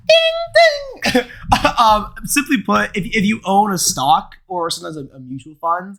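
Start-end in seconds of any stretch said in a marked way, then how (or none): 0.1-1.2 very high, loud sung "ding"; bell-like high note; imitated chime. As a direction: cap the jingle with a high ding. Caps the jingle with chime.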